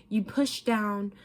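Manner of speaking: normal talking voice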